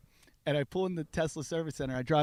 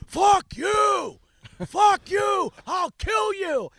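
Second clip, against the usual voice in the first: high, strained mock scream of rage; imitated screaming; 8 dB louder, much slower than usual. Enraged driver yelling at the man laughing at him, screamed. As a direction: scream it drawn out like a man losing it. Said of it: "he's screaming at me".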